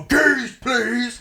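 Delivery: high-pitched imitation of a dad's yell; hammed-up high yell; retold yelling. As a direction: mimic an irate dad yelling a demand. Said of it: "he's just yelling at the car".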